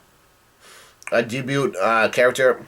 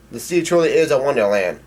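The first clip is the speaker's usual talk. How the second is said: ordinary delivery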